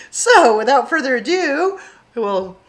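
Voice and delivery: usual speaking voice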